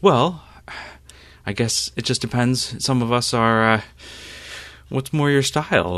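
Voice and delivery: a sharp-tongued rival; Rune. Acted. GM voices Rune.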